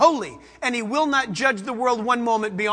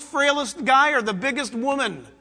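ordinary talk

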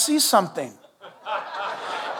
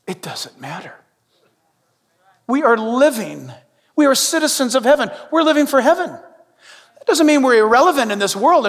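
usual speaking voice

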